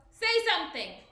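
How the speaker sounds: loud commanding shout. A narrator's reading. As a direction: bark the order into the mist. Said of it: "he shouted".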